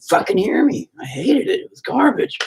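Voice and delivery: high exasperated complaint. Real, not acted. Venting about yesterday.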